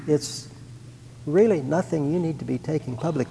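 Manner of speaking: soft, tempting murmur of the devil; lightly voiced devil coaxing them to keep quiet; hushed devil voice. The devil tempting the couple to keep it private, softly.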